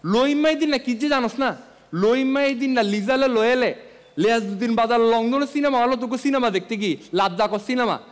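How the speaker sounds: loud rapid Chittagonian dialect; Chittagonian accent. Retold or imitated. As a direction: rattle it off in thick Chittagong Bengali. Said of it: "কালামানিক খেয়াল করলে বলে".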